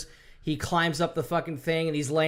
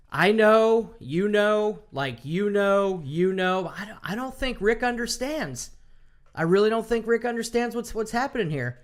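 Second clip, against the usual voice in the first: mock Negan; 6 semitones higher than usual. Negan toying with Rick before the execution, lightly mocking. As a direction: toy with him in a sing-song way.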